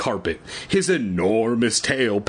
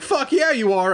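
high, loud eager reply; pumped-up agreement; fired-up yes; excited yes; 13 semitones higher, 3 dB louder than usual. Retold or imitated. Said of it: "And I'm like".

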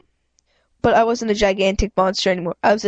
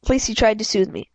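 ordinary delivery, nothing marked

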